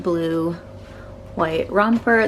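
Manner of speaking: ordinary delivery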